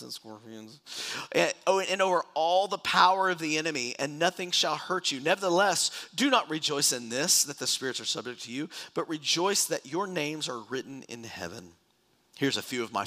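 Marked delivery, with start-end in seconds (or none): 1.3-11.7 raised, emphatic Bible reading; preacher reading a promise aloud; scripture reading. Acted. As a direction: proclaim it to the congregation.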